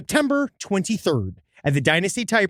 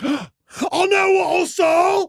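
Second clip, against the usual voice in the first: gasp, then a high, drawn-out cry; accented outburst; 12 semitones higher, slower than usual. Acted. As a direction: shout it in a hammy British accent. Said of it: "it almost comes across different when in that accent".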